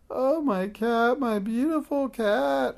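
high, loud, slow wailing witch; howling grief. A narrator's reading. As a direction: wail over her lost cat. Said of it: "howled Madame Snickesnee".

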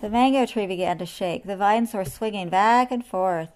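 ordinary delivery

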